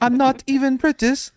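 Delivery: high-pitched imitation British voice; mock British accent. Host imitating girlfriend's protest, high mock British accent. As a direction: squeak it out as a fake British girlfriend. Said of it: "you sound British to me".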